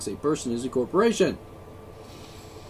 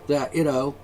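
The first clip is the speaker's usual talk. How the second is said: normal talking voice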